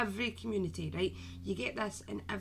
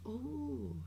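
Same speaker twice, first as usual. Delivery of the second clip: soft whispered marvelling; hushed aside; 9 dB quieter than usual. Real, not acted. Live host shows viewers something, in a hushed whisper. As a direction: drop right down to a near whisper.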